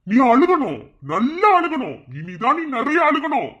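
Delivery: high, loud gloating yell; vengeful shout. Acted. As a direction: roar at her to cry. Taunting wife to cry.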